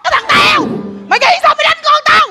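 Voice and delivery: put-on shouted tirade at a neighbour's boy; angry scolding. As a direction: yell it over the fence. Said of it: "bà chửi một hồi".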